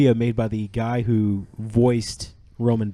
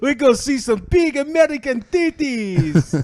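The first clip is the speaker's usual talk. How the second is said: put-on high, laughing Russian-accent cousin; 12 semitones higher, slower than usual. Roman invites Nico out, high laughing Russian accent. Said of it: "give you a Russian accent".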